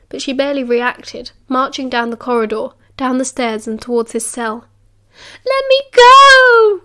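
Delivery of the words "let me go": very high, loud, slow scream; child screaming. A narrator's reading. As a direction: scream it, beyond control. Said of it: "he screamed".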